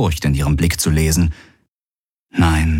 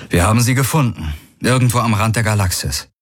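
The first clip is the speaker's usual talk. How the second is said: quick telling; 4 semitones higher, faster than usual. Acted. Galen tells his story.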